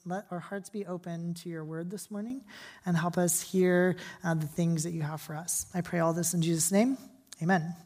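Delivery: soft, hushed pastor's prayer. Acted. Pastor praying for the congregation, hushed and earnest. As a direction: pray quietly on behalf of the room.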